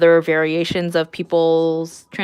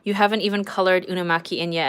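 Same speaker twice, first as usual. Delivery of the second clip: ordinary talk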